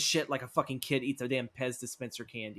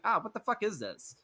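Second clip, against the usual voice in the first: high, startled outburst at the odd pet; a confused son staring at the box; 7 semitones higher than usual. Retold or imitated. Son meets strange pet, higher startled voice. Billy reacts to strange creature.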